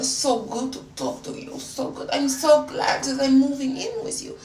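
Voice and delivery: put-on high French-accented gush, easing down; hammed-up French girlfriend voice. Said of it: "I spoke in a French accent".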